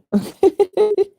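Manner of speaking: a sudden high-pitched laugh; real amused giggle. Real, not acted. Laughs at own joke.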